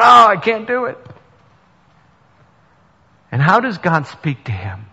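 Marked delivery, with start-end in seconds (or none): 0.0-1.0 high, loud, whiny cry of giving up; despairing Elijah. Retold or imitated. Elijah hiding in a cave, loud despairing whine. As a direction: wail it like a man cowering in a cave.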